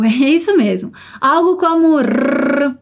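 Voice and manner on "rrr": long rolled rrr like a cooing pigeon; rolled rr trill. Shown as a demonstration. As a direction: rattle the tongue in a slow trill. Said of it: "a gente tenta imitar um pombo".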